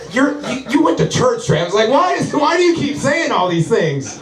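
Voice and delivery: high-pitched, pleading mock exasperation; exasperated self-scolding. His conscience scolding him mid-fight, high exasperation. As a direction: scold yourself in hammed-up disbelief, pitched high.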